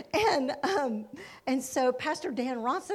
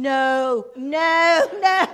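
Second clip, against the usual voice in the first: loud, drawn-out refusals; hammed-up pleading objection; mock protest; 3 semitones higher, 11 dB louder, much slower than usual. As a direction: refuse in dread, each one louder. Acts Ron refusing over the phone.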